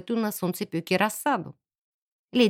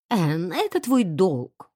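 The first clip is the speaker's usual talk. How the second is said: slow, lower, stern maid; frowning; 4 semitones lower, slower than usual. A narrator's reading. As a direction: say it slowly with a disapproving frown. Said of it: "нахмурилась Сиси". (строго, нахмурившись)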